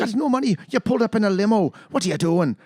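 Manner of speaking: high, scolding mock Irish accent; lightly done scolding Irish wife. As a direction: nag him in a fake Irish lilt. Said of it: "the accents didn't work for me".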